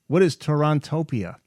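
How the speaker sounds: ordinary talk, nothing marked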